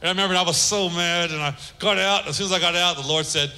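ordinary talk

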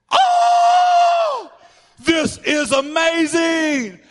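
high, loud, drawn-out crowd cheer; hammed-up ecstatic shout; mock wild cheering. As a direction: whoop like a whole class going crazy. Retold class going wild.

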